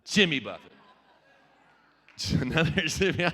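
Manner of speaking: ordinary talk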